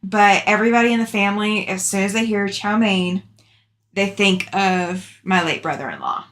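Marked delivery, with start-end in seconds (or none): none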